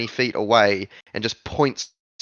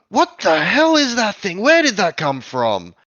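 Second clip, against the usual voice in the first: high, loud goblin shout; hobgoblin calling out; 8 semitones higher, 6 dB louder than usual. Acted. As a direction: call your friend over, alarmed and loud. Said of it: "you hear in Goblin".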